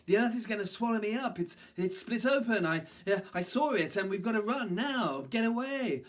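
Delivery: high, loud, frantic monkey warning; urgent panic. A narrator's reading. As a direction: blurt it out in alarm. Monkey urgently warns the others.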